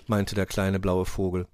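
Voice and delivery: usual speaking voice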